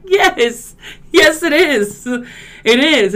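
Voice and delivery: loud, high-pitched mock insistence; retold argument. Friend arguing over Stewie's accent, high insistent retort. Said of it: "And I was like".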